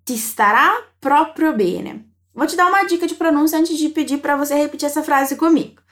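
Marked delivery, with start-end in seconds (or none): 0.0-2.1 slow, clearly spaced words; slow model phrase. Shown as a demonstration. Teacher shows a compliment in Italian, slowly. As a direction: lay each word out for the learner. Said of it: "E aí fica assim".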